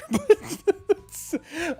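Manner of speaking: shrill burst of laughter; high squealing laugh. Real, not acted. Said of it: "Oh, now Minnesota".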